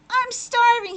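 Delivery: high, loud eager exclaim; hungry child bursting out happily; excited hunger. Acted. Albert announces hunger to family, high and loud.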